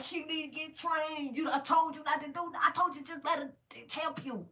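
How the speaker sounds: high-pitched mimicry of a coworker's complaint; imitating Rachel. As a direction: voice her griping up high, without shouting. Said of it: "Rachel was like".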